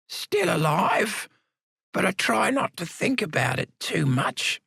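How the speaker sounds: put-on high, slow old man's voice; wry old convict, stretching out still alive; frail old man.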